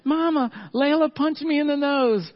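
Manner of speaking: put-on high, whiny little boy voice; squeaky crying-kid voice; crying toddler. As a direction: voice a three-year-old wailing. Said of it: "Henry banging on the door".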